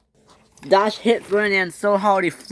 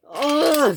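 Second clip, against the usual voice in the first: high "ugh"; strained groan; 10 semitones higher than usual. A narrator's reading. Dash among the crashing engines, strained groan.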